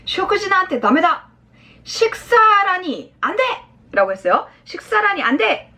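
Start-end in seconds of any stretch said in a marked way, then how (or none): 0.1-3.4 raised-pitch, rigid refusal; acted stern veto in a higher voice; stiff fatherly firmness. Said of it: "이렇게 좀 딱딱하게". 4.6-5.7 high-pitched, insistent fatherly command; acted stern exclamation; firm order. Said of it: "약간 남자 용어를 쓴".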